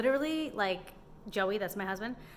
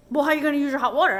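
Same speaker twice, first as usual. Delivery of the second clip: louder, fast imitated challenge; mock challenge; 6 semitones higher, 11 dB louder, faster than usual. Mimicking the man challenging her about hot water, loud and fast. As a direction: fire his question back quickly.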